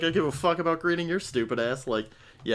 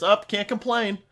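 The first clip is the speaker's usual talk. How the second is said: cashier catchphrase; 9 semitones higher, 5 dB louder, slower than usual. Shown as a demonstration. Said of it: "my thing that I always say is".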